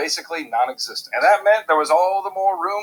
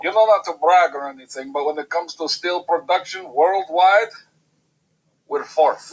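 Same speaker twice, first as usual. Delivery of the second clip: hammed-up, terrible Russian accent; Gru-like bad Russian accent; 3 dB louder than usual. Russia humble-bragging about steel production, in a bad Russian accent. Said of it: "that terrible Russian accent".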